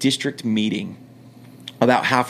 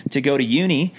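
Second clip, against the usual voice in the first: higher-pitched put-on Australian voice; Australian accent; 4 semitones higher than usual. Fake expat explaining himself at the window, Australian accent. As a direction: keep up the Australian act. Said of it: "I kept the act up".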